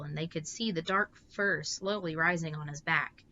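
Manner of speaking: usual speaking voice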